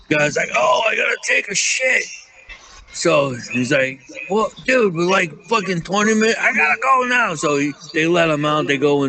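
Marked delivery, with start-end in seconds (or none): 0.5-2.0 high, desperate whine; urgent drunk guy. Retold or imitated. Drunk friend urgently needs toilet. 4.3-7.3 high, fast, pleading whine; desperate plea. Retold or imitated. Drunk friend insisting on stopping.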